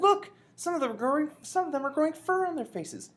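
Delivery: high, loud alarmed exclamation; excited cry. A narrator's reading. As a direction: call it out high, pointing out something astonishing. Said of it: "I cried".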